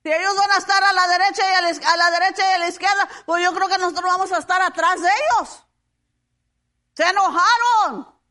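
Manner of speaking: high, loud, rapid-fire protest from the pulpit; fast and forceful, landing hard on the last words; preaching. Real, not acted. Preaches, voicing the disciples' complaint.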